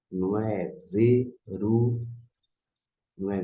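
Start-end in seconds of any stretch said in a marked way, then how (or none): 0.9-2.1 "veru" drawn out in two slow beats, cut before the final h; wrong pronunciation shown. Shown as a demonstration. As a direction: slowly model "veru" with no throat sound at the end. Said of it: "a pronúncia".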